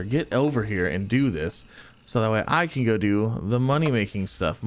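ordinary delivery

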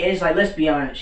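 normal talking voice

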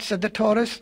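ordinary delivery

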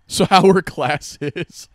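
loud, slow, overly bright question; change the subject awkwardly and loudly; forced cheer to dodge the topic. Real, not acted. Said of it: "I'm really uncomfortable right now".

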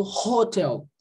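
hotel with a breathy R-like H at the start; English hotel. Shown as a demonstration. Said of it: "Tem som de R". (hotel com H soando como R)

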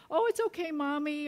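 high-pitched mock child reply; imagined little boy. Six-year-old imagined making up with Mommy, high and childlike. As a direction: pitch it up like an agreeable little kid. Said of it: "what do you think Jake said".

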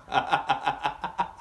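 soft, even string of laughs; staccato laugh. Real, not acted. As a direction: laugh in a long quick string. Amused reaction to the episode.

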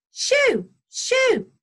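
high, loud shoos; piercing jackdaw rebuff; shouted shooing. A narrator's reading. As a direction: drive the intruder off sharply. Jackdaw drives off the stranger.

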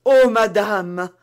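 slow, loud, heartfelt exclamation; fervent cry. A narrator's reading. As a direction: cry out while falling to her knees. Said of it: "s'écria la jeune femme en tombant à genoux". (cri fervent, lent et fort)

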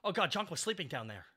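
ordinary talk

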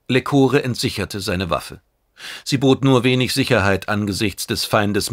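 usual speaking voice

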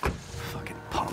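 whispered slow curse under the breath; muttered insult. Acted. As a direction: say it so the agent can't hear. Insult after agent leaves.

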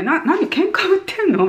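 high startled comeback; mock offended reply. Imagined reply to a rude thanks, offended. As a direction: react as if provoked. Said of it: "みたいな感じ".

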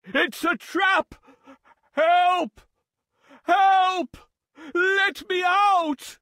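alarmed, desperate calling from a trap; panicked cries. Acted. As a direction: yell out as a trapped lion. Lion caught in a trap.

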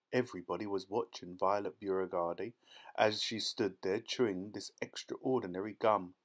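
ordinary delivery, nothing marked

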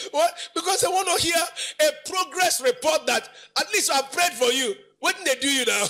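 high preacher's exhortation; impassioned preaching. Acted. Preacher telling what supporters want to hear, impassioned.

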